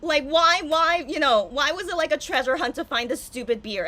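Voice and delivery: loud, high exasperated rant. Retold or imitated. Streamer retelling her thoughts to viewers as a loud exasperated rant. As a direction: rant like it was a ridiculous quest. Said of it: "But I'm like".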